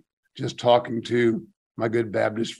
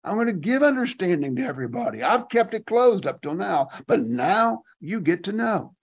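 higher-pitched, emphatic voicing of God's promise; God proclaiming; 6 semitones higher than usual. Preacher voices God promising understanding to everybody, raised proclamation. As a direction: proclaim it high, as God announcing a turnaround. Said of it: "God is saying".

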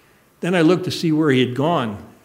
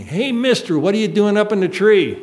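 put-on high, curious child calling up; boy calling up to a man in a tree; little boy voice; 7 semitones higher, 4 dB louder than usual. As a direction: play a young boy asking a stranger. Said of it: "here's this little boy".

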